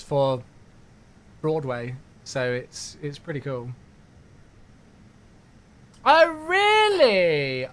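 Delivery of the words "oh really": very slow, high and loud drawn-out disbelief; surprised. Real, not acted. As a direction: stretch it out, genuinely amazed. Viewer says they saw the show.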